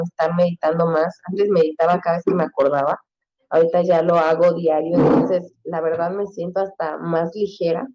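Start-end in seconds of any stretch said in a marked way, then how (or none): none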